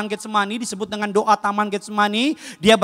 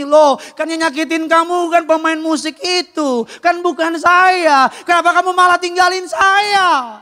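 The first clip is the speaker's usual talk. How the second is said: high, rising cry as God; impassioned protest; 12 semitones higher, 5 dB louder than usual. Acted. God asking believers why they left Him, crying out in protest.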